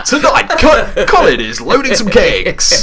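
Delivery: high, loud, slow mock announcer; teasing a friend as a Blind Date presenter; game show host. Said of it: "doing Blind Date".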